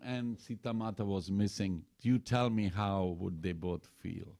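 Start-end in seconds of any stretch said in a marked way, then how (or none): none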